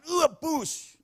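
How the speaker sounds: high "wah, push" sound effect; imitated gushing burst. Repeats the sudden gushing noise.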